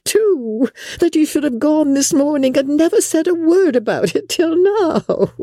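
very high, squealing matron's delight; rapturous. A narrator's reading. Wife thanking husband for visiting Bingley, rapturous. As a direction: gush ecstatically at the top of your range. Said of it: "fatigued with the raptures of his wife".

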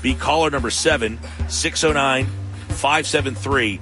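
normal talking voice

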